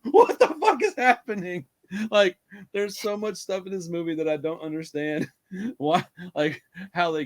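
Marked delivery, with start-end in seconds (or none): 0.0-1.7 higher, louder disbelief, slowed; what the hell is going on, stunned; incredulous. Retold or imitated. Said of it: "I was like".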